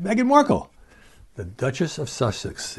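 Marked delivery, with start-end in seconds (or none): 0.0-0.7 name pitched high with theatrical disbelief; mock astonishment. Real, not acted. Host telling listeners the unlikely candidate, mock astonishment. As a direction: say the name like it's unbelievable news. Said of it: "I don't even have to identify her".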